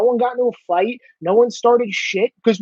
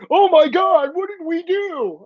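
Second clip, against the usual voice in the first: higher, slow mock panic; mock dismay; 6 semitones higher, slower than usual. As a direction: play their fake horror mockingly. Mocking their sudden regret.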